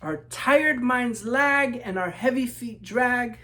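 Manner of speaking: lilting rhyming read-aloud; story narration. A narrator's reading. Author reading to children, storybook narration. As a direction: read it like a picture book to kids.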